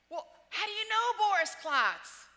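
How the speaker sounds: high, loud, slow yell; imitated guard screaming. Airport guards yelling at the nervous traveller, loud slow yell. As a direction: hammer the question like a furious guard. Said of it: "they start screaming at me".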